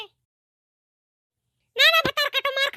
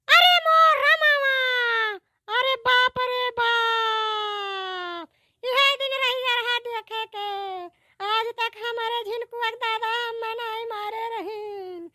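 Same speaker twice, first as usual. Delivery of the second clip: put-on wailing old woman; 4 semitones lower, much slower than usual. Grandmother wailing to her son about being threatened, slow crying. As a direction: wail and sob like a wronged grandmother.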